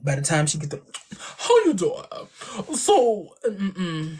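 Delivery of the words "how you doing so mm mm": Wendy Williams impression. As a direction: belt the greeting like Wendy Williams. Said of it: "impression spot on".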